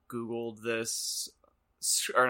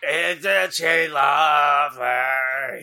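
slow, loud, throaty character voice; gargling marbles; 3 semitones higher, 7 dB louder, much slower than usual. Acted. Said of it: "so I can't talk like I'm gargling marbles?".

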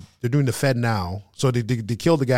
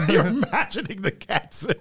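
laughing through the words, slow; amused disbelief, chuckling it out; 6 semitones higher than usual. Real, not acted. Friend laughing at the idea of imagined cancer.

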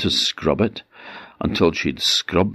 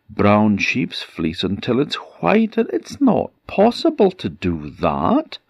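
storyteller's upset princess, high-pitched; distressed protest; 6 semitones higher, 3 dB louder than usual. A narrator's reading. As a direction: pitch climbing high in helpless complaint. Princess protests her impossible task.

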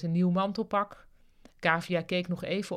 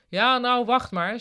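raised, higher-pitched voice; calling over jeers; 6 semitones higher, 8 dB louder than usual. A narrator's reading. Ruud on a chair to jeering colleagues, calling out. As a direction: hold off a noisy crowd. Speaker answers the crowd's jeering.

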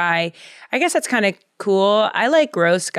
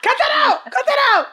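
comic catchphrase; faster than usual. Acted. Laughing, jokey catchphrase.

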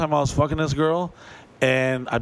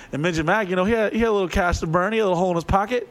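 ordinary talk, nothing marked

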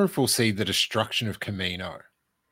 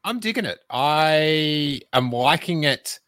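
mock grudging enthusiasm; 5 semitones higher, slower than usual. Real, not acted. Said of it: "I've just got a medium level of exci".

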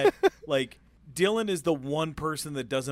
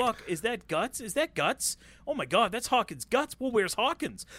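rising, higher alarmed questions; confused shock; 8 semitones higher than usual. Retold or imitated. Friend recalling his first watch, asks in higher rising alarm. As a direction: voice the panic of a first-time viewer. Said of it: "I was like".

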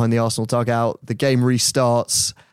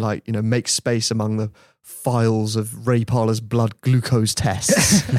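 normal talking voice

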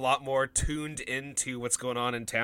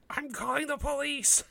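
put-on high, softer child voice; kid's voice; 9 semitones higher, 5 dB quieter than usual. Kid threatening the wolf at the door, high voice.

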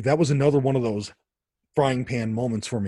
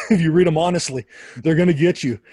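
louder, laughing through the words; 4 semitones higher, 6 dB louder than usual. Real, not acted. Joking that the church fathers get you, laughing. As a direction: chuckle it out, delighted.

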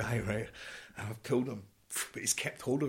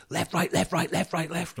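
high, barked marching count; drill commands; 9 semitones higher, 3 dB louder than usual. Retold or imitated. Sergeant major marching a soldier in, barked drill count.

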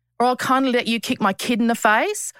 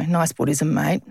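sarcastic; 5 semitones lower than usual. Retold or imitated. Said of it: "people say to me all the time online".

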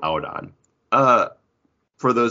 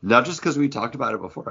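usual speaking voice

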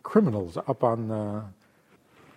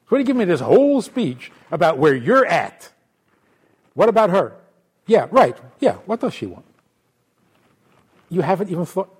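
louder rebuke; scolding counselor; 3 semitones higher, 3 dB louder than usual. Acted. Counselor scolding the self-absorbed husband, louder. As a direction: tell him off.

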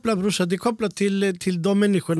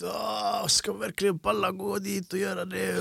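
weary sigh; 7 dB quieter, slower than usual. Retold or imitated. Reluctant thought about going out.

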